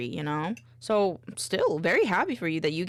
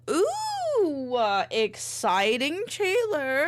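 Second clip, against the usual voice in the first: excited; 7 semitones higher, much slower than usual. Real, not acted. Praising viewer's volunteering.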